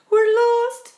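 high, loud cry of doom; despairing cry. A narrator's reading. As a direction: cry out that all is over. Crash into rainbow looms.

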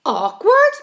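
high, loud rising question; outraged earthworm exclamation; incredulous cry. A narrator's reading. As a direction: throw the word back in disbelief. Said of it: "cried the earthworm".

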